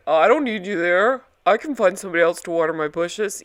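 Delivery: a bad impression of a drunk jerk brushing her off; terrible Eddie impression. [name] mocks Eddie brushing off his girlfriend, bad impression. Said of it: "that's a terrible Eddie impression".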